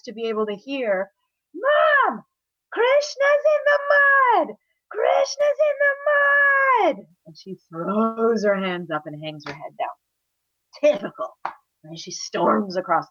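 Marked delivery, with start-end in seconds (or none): 1.5-7.1 very high, loud tattling yell; screaming. Acted. Boy telling mother about muddy brother, screaming. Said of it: "is Balaram screaming and waving". 10.8-11.4 higher one-word huff; exasperated. Acted. Mother hearing son is in mud, exasperated.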